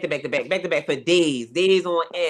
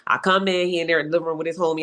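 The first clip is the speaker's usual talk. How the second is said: ordinary delivery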